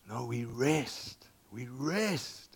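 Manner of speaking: slow, drawn-out rest; slow emphasis. Shown as a demonstration. Preacher contrasting the true week, slow and firm. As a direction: lean into rest, slowly. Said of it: "Over here".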